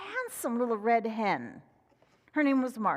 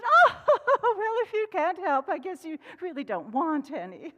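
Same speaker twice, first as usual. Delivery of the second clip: higher hen laughing it off; 6 semitones higher, 5 dB louder than usual. Acted. Hen dismissing Fox with a laugh. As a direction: laugh and brush him off. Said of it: "and she said".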